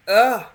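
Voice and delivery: loud groan of annoyance; exasperated groan. Real, not acted. Annoyed at being ordered about.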